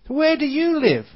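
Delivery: high, loud eager question; eager spider question. Acted. Sad spider presses the fat spider, high and loud. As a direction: blurt it out, dying to know. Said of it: "said the suicidal spider".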